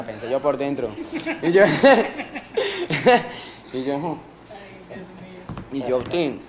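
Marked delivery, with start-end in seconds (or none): 1.7-3.5 high-pitched burst of laughter. Real, not acted. Se ríe al contar. (risa aguda)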